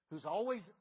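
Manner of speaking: ordinary talk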